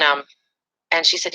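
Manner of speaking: usual speaking voice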